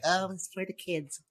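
a lightly put-on prim voice; mocking whiny excuse. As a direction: parody a prissy excuse-maker. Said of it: "don't come to me with, like".

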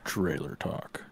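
deep movie trailer announcer. Pretend. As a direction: drop low like a trailer narrator. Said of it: "that's my announcer trailer voice".

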